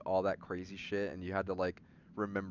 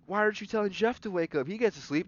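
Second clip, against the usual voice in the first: high, fast, whiny student voice; whiny classmates; 11 semitones higher, 5 dB louder than usual. Retold or imitated. Students complaining that Jeff gets to sleep, high whiny mock voice. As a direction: whine like a jealous kid in class.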